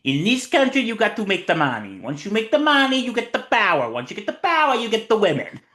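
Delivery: high-pitched, hammed-up Cuban gangster impression; Tony Montana. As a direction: do a terrible Pacino as a swaggering movie gangster. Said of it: "my terrible Al Pacino impression".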